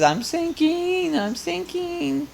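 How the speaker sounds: very high, slow witch wail; a wailing Wicked Witch, sinking away. Acted. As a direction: stretch it out high like a dissolving witch. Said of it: "Wizard of Oz?".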